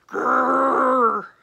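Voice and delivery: loud rumbling growl; tiger growl. A narrator's reading. Tigers answering the boy with a loud growl. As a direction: growl back angrily. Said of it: "the tigers only answered".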